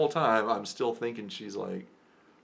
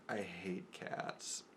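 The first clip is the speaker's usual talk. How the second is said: very slow, hushed retort; imitated slow delivery; 2 semitones lower, 10 dB quieter, much slower than usual. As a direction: drag out each word softly, imitating her. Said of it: "she's going so slow when she's doing it".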